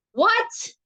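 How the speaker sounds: high exasperated shriek on What; screamed with exasperation. A narrator's reading. Harriet fed up with old Golly's quotes, exasperated scream. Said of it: "Harriet screamed with exasperation".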